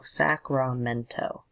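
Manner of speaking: Sacramento slowly, SAC-ra-MEN-to; careful pronunciation. Shown as a demonstration. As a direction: sound out "Sacramento" syllable by syllable. Repeats word after spelling it.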